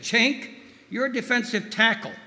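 high, loud coach-like bark; imitated yell. Mentor to defensive tackle at scrimmage, imitated yelling. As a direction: imitate a mentor yelling right in your face. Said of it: "yelled in his distinctive accent".

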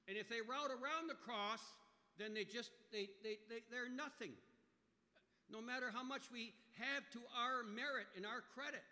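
emphatic preaching. Real, not acted. Preacher telling the congregation that merit counts for nothing, emphatic. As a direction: press the point loudly from the pulpit. Preacher presses his point at high pitch and volume.

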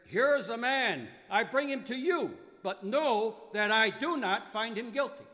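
Pilate declares it to the mob; loud declaration. Acted. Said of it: "Pilate declared".

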